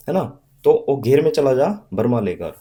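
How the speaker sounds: ordinary delivery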